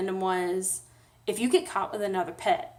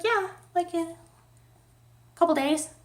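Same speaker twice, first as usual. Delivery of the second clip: higher, offhand mock receptionist; mocking staff voice; 8 semitones higher, faster than usual. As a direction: toss it off like a careless clerk. Mimicking the clinic staff.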